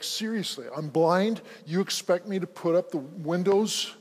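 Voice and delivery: loud, incredulous protest; indignant retort. Retold or imitated. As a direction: throw the question back, offended. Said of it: "it ticked him off".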